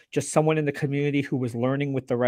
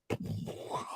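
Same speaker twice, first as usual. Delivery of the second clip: mock gasp of amazement; awed gasp; 15 dB quieter than usual. Amazed users react to a simple tip, gasping. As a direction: gasp like an astonished customer. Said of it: "people are like".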